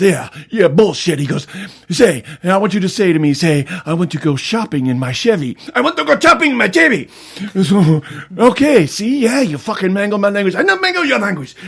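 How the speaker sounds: high, loud, frantic yelling; shrieking quarrel. Retold or imitated. Two shouting over mangled Spanish, shrill and loud. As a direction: yell insults back and forth.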